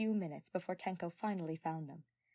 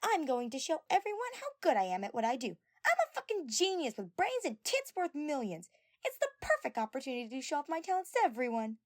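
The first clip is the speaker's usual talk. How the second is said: loud, higher bragging voice; boastful; 9 semitones higher, 5 dB louder than usual. A narrator's reading. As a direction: show off defensively. Mew boasting, flustered.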